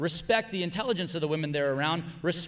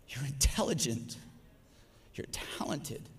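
tender affirmation; 6 semitones lower, 9 dB quieter than usual. Real, not acted.

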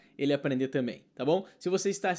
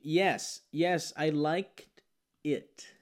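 very slow, careful yes, I liked it; slow correct model; much slower than usual. Shown as a demonstration. Teacher models the correct answer slowly. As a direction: lay each word out for the class. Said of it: "Eu gostei do filme".

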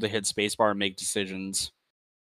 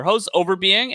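announcer voice; 8 semitones higher than usual. Acted. Announcing own host name.

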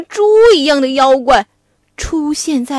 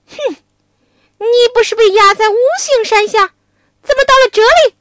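pig demon; 8 semitones higher, 5 dB louder, much faster than usual. Acted. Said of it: "妖怪边打边问".

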